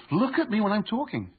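father scolding his son, mock yell; retold yell. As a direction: snap it like a fed-up dad. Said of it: "I yell at him".

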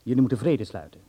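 loud, firm orders that allow no argument; stern command. Acted. Doctor ordering Harm downstairs, loud stern command. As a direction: put your foot down, loudly.

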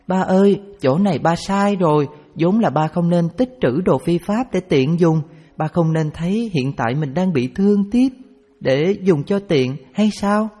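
put-on high childlike voice, chiding; a small girl lecturing her dad; little daughter scolding. A narrator's reading. Daughter tells father he was wrong, high scolding child voice.

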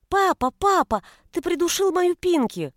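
put-on high, loud child's protest; upset little girl. A narrator's reading. Little girl accusing father over squashed pet, upset. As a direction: wail at papa like a small child.

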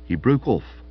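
ordinary delivery, nothing marked

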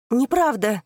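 sharp loud cry of denial; exclaiming. Acted. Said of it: "воскликнула она".

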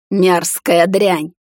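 slow, loud spat insult; contemptuous snort. A narrator's reading. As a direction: spit it out with contempt. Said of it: "фыркнул Клода". (презрительное фырканье)

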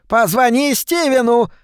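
high yell to her husband. A narrator's reading. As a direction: wail it at the husband. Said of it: "завопила миссис Шефер мужу". (завопила)

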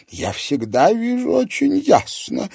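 a hint of an offended seer; old fortune-teller. A narrator's reading. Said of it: "возразила Альберта".

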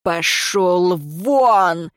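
slow, drawn-out roar; angry bellow. A narrator's reading. Lord shoves servant aside, angry bellow. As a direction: bellow him out of the way. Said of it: "заорал на него высокородный".

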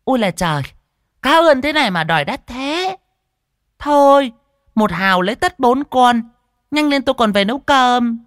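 high, loud haggling shrew; shrewish. A narrator's reading. Fat woman haggling over fish, shrewish high and loud. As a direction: complain in a high, loud, sharp-tongued voice. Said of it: "Cái giọng đanh đá vang lên".

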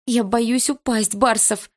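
slow, high, scared plea; frightened, clinging to the fence; trembling voice. Acted. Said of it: "ответила я дрожащим голосом".

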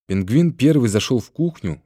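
ordinary delivery

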